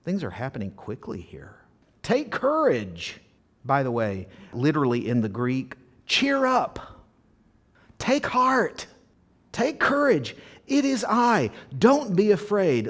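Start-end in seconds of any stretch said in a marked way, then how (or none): 2.0-3.2 high, slow exhortation; encouraging call. Acted. Jesus to frightened disciples, high encouraging call. 6.1-12.9 high-pitched, emphatic exhortations; encouraging call. Acted. Jesus to frightened disciples, high emphatic encouragement.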